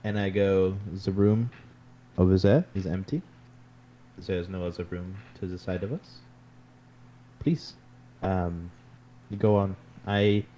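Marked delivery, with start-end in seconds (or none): 1.1-9.8 lightly voiced, measured investigator; a hint of a formal character voice; courteous character, inviting confidence. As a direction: reassure in character, with pauses.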